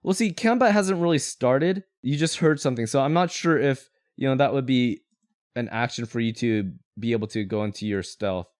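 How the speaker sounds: normal talking voice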